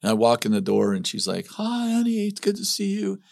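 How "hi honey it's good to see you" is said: high-pitched imitation of a wife's greeting; cheery wife. Wife greeting husband home from a trip, high mock-cheery voice. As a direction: play the welcoming wife, pitched way up.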